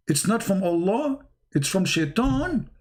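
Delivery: lightly acted disbelief, rising question; incredulous Muhammad. Muhammad learns the verse's source, mock-incredulous question. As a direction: echo it back as a mock-shocked question. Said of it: "You must be kidding me".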